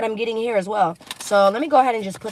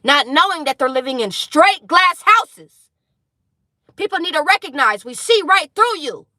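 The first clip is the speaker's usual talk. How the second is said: louder, higher call-out; loud outburst; 6 semitones higher, 10 dB louder than usual. Real, not acted. Reader shouting down fake people watching. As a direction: call them out at full volume. Heated rant about glass houses.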